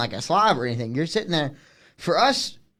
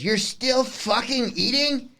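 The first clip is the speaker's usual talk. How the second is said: high, slow, drawn-out disbelief; mock the lady's horror at the tenth wing; appalled disbelief; 9 semitones higher, slower than usual.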